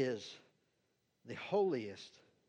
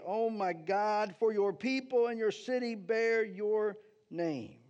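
slow, raised, impassioned prayer reading; fervent prayer; 4 semitones higher, slower than usual. Acted. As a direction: plead with God, drawing out each O Lord. Pastor reads Daniel's prayer aloud.

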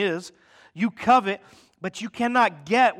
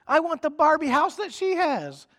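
put-on much higher, whiny child voice; mock five-year-old wanting a toy; 9 semitones higher than usual. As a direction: whine like a greedy little kid. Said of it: "We sound just like a five-year-old".

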